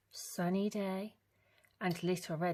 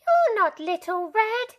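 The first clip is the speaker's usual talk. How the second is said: put-on high loud furious old lady; high angry granny shout; outraged granny; 12 dB louder than usual. A narrator's reading. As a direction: shout him off the doorstep. Granny yelling.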